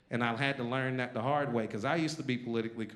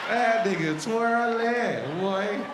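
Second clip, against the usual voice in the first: drawn out, louder, laughing through it; loud slow punchline; 8 semitones higher, 6 dB louder, much slower than usual. Real, not acted. To the crowd, he tops his joke, loud and slow. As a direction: stretch twirling out big and loud.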